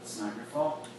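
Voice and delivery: softly steady, a touch of tenderness; quiet and firm, looking him in the eye; tender, resolute. Retold or imitated. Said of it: "full of tenderness".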